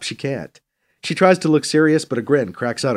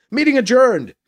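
high, raised-voice announcement; needlessly loud; 11 semitones higher, 5 dB louder than usual. A narrator's reading. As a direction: close the meeting too emphatically. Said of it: "louder than he needs to".

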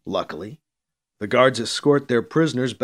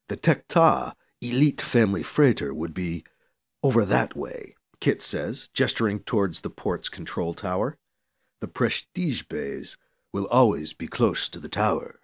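a calm, lower-voiced guide; 5 semitones lower, 4 dB quieter than usual. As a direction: speak low and matter-of-fact. Kit's lower character voice.

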